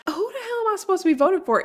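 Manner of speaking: high, fast exasperated question; retold exasperated outburst. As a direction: rattle it off high, in mock bewilderment. Retelling her exasperation over the poll.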